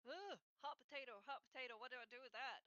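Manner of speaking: a hammed-up panic over a hot potato; mock panic. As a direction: caught off guard, flapping in mock alarm. Flustered reply to a real answer.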